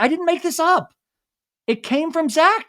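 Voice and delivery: high-pitched imitation of indignant denial; retold plea of innocence; defensive protest. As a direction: protest in a mock-aggrieved high voice. Acting Doty's defensive protest.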